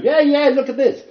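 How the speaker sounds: high, loud mock-excited crowing; mock showing off. Preacher mimics boasting to his congregation, mock excitement. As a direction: crow it like a show-off, hammed up.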